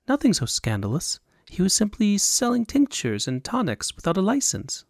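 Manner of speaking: lightly voiced secretive young woman; a hint of a noblewoman asking a favour; young woman's voice. Woman hiring Roland in secret, lightly voiced.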